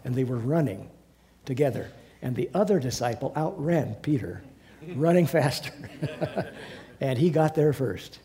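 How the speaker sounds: ordinary delivery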